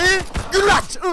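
high, loud excited yell; excited shout. Real, not acted. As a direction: cheer it out.

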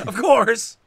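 playful mock voice. Echoing friend's line playfully.

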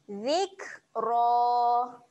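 Zikra with a heavy, thick ra; Zikra demo. Shown as a demonstration. Teacher models thick ra for students, Zikra. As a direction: round the ra full in Zikra. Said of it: "tebal kan bunyi huruf ra".

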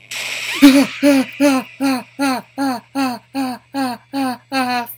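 rapid burst of loud, high screams; real startled shrieks; panicked screaming. Real, not acted. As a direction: scream over and over in short bursts. Startled by scare in horror game.

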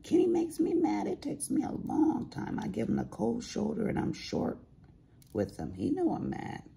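high, put-on voice of mom complaining; imitating mom. Daughter imitating her mom griping about Kenny, high put-on voice. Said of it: "and she's like".

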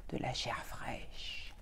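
hushed and slow; whispered. A narrator's reading. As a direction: breathe the words out. Loup guette sa proie. (chuchoté)

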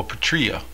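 "patria" said as puh-TREE-uh, softly; second guess at "patria", weak first vowel; alternative pronunciation. Shown as a demonstration. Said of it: "correct pronunciation".

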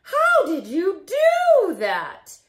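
high astonished cry; shocked squeal, then slow wonder. Acted. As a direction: gasp it out in disbelief. Astonished at friend's spin.

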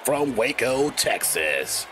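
slow, booming ring announcer intro. Acted.

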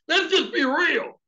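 loud high laughter into the words; laughing hard, very high and loud; big laugh. Real, not acted. Preacher cracking up before the congregation, laughing loud.

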